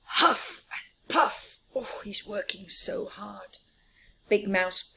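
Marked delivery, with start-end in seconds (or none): 0.0-1.6 slow, high puffing breaths of effort; huffing and puffing. Pretend. Narrator acting Big Mouse straining at the wheelbarrow, huffing. Puffing while working hard. 1.7-3.6 whispered aside. Real, not acted. Aside to young listener.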